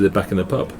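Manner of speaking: ordinary talk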